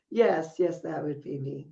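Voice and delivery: normal talking voice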